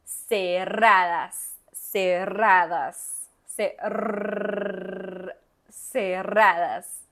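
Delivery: cerradas loud and slow with a heavy trilled rr; loud exaggerated trill drill; strong rolled r. Shown as a demonstration. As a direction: hammer the rr in cerradas as hard as you can. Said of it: "habla el más fuerte que puedas".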